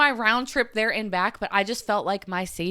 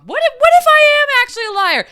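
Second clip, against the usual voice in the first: anguished outburst; 10 dB louder, faster than usual. Real, not acted. Spiralling self-doubt outburst.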